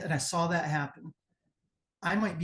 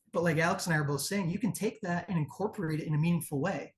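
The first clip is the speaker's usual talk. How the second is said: usual speaking voice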